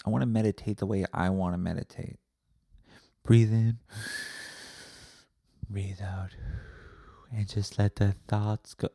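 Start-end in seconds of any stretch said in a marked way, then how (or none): 3.2-8.9 mock meditation guide. Meditation teacher instructs the class, imitated calming voice. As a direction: guide the breath, easing off after the first words.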